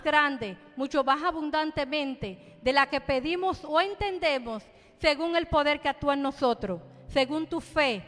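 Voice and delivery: fervent pulpit exhortation; preaching. Acted. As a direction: call them to come to the Lord. Preaches to the congregation.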